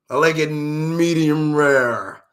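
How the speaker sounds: slow, drawn-out fancy order. Retold or imitated. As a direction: stretch out medium rare like a prim diner. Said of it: "They like they food medium rare".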